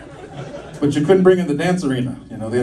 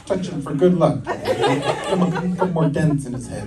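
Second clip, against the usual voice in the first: mock soothing nurse; 8 semitones higher than usual. Nurse reassures the doctor.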